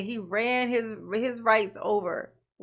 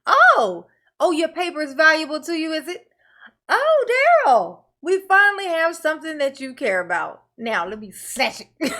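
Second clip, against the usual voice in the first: mocking the judge; 5 semitones higher, 5 dB louder than usual. Judge taunting Daryl about his precious paper, gloating. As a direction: taunt him like you finally have leverage. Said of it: "she like".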